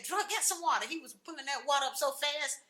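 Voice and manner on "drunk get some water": high, loud urgent command; urgent order. Retold or imitated. Father ordering water to fight the fire, loud. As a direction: bark the order to fetch water.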